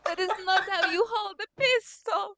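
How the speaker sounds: high-pitched, hammed-up Italian accent; mock Italian accent. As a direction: scold in a fake Italian accent. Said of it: "why are you doing an Italian accent".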